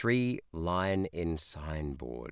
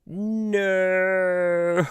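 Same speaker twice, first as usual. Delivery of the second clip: long, very high, loud "no" breaking up; anguished wail; 14 semitones higher, 8 dB louder than usual. Real, not acted. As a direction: wail in defeat. Wrong guess, wails in defeat.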